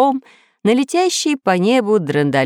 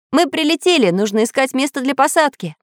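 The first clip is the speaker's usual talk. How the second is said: high-pitched, eager announcement; delighted; 9 semitones higher than usual. A narrator's reading. Samodelkin tells crew to find landing spot, high and delighted. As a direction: announce the arrival in a raised, glad voice. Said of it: "обрадовался Самоделкин".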